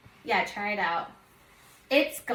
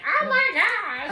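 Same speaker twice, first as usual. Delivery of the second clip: high, louder exclamation of horror; mock schoolkid shock; 10 semitones higher, 3 dB louder than usual. Middle schooler reacting to hairy armpits, mock shock. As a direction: imitate a scandalised middle schooler. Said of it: "it was like".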